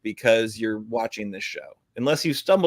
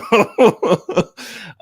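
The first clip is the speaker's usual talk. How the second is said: high laugh at the joke; 12 semitones higher, 8 dB louder than usual. Real, not acted. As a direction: burst of laughter. Laughs at new producer joke.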